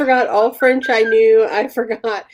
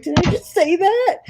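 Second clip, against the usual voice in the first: high, rising disbelief; mock mortified question; 9 semitones higher than usual. Friend imitates the other's regret, high mortified question. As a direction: ask it high, as if appalled. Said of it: "the way you looked at me".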